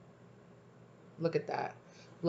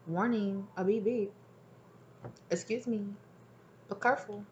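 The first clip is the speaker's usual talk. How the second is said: higher, softer warning voice; spirit's warning; 4 semitones higher, 6 dB quieter than usual. Acted. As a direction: softly sound a little alert, pitched up. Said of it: "Spirit said".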